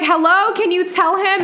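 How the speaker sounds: exasperated appeal, acted out; retold yelling. Sister yelling for silent brother to back her, high exasperation. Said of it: "yelling, and my brother is super quiet".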